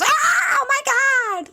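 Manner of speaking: loud, very high imitated shriek; screech. Stepmom walking in on the outfit, imitated screech. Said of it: "Like, she screeched".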